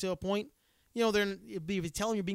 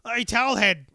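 mock taunt; 4 semitones higher, 11 dB louder than usual. Mocking the racist passengers.

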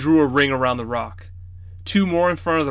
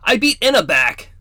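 put-on boyish brag; 8 semitones higher, 6 dB louder than usual. Boy brags to grandfather.

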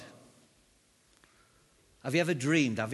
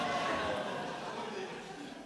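airy laugh after the shout; breathless laugh; 8 dB quieter than usual. Real, not acted. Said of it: "laughing after the shout".

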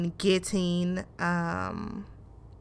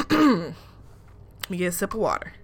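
ordinary talk

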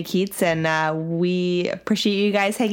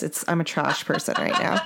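normal talking voice

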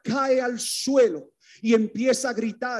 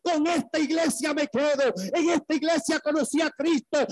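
high ringing preacher's proclamation; preaching at full force; 6 semitones higher than usual. Real, not acted. Preacher proclaiming the church of Christ to the congregation, full force.

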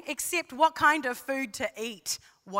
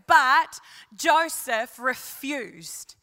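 slow and loud, each word landed; slow down and give every word weight; three words spoken slowly and loudly; weighty pronouncement; 6 dB louder, much slower than usual. Shown as a demonstration. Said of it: "these three incredible words".

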